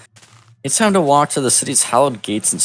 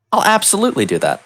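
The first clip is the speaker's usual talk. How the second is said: much higher, eager yes-man; eager agreement; 11 semitones higher than usual. Acted. Hero agreeing to the mayor's plan, much higher and eager. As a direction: pitch it up like an overkeen hero.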